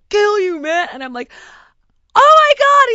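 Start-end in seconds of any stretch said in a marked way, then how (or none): 0.1-0.9 threat. Retold or imitated. Guy threatening her brother. 2.2-2.9 much higher, much louder "oh my God"; shocked cry. Retold or imitated. Hearing a fight over the phone, a shocked much higher shriek.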